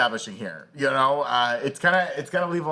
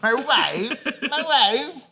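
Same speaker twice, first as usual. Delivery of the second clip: high put-on voice through laughter; hammed-up husband impression, pitched way up; comic catchphrase impression; 10 semitones higher, much slower than usual. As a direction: parrot the catchphrase in a silly high voice.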